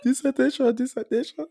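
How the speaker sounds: high mock whine of dissertation; mocking imitation. Teasing friend who won't stop saying dissertation, high mock voice. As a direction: squeak it like a nagging student.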